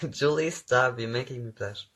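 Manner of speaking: normal talking voice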